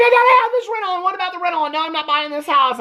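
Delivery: high-pitched mock sobbing whine. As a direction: imitate a weepy wife pleading, pitch way up. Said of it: "so over sobbing Robin".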